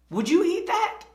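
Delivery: high, loud incredulous question; incredulous challenge. Retold or imitated. Dad to an employee about the plate, incredulous. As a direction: imitate a dad challenging sloppy work, pitch jumping up.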